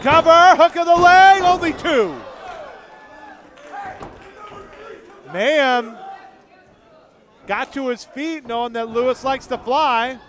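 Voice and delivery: rising, shouted play-by-play; wrestling commentator. Real, not acted.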